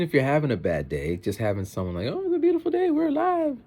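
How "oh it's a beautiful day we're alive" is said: very high, sing-song friendly greeting; cheery store clerk. Retold or imitated. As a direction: chirp it like an overly cheerful clerk. Imitating clerk's cheery catchphrase.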